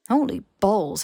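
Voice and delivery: usual speaking voice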